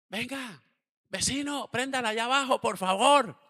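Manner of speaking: high, loud mock-pleading neighbor. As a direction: beg him to take the noisy bike elsewhere. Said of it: "Ya los vecinos decían".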